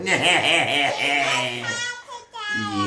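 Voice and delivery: a mock evil laugh; villain chuckle. King Nachash laughing at his own meanness. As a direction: laugh like a bossy bully.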